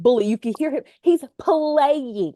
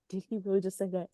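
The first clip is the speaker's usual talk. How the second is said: stunned murmur; 4 semitones lower, 12 dB quieter, much faster than usual. Retold or imitated.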